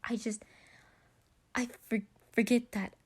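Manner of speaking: embarrassed stammer; flustered apology. Acted. Patton embarrassed after lifting Logan.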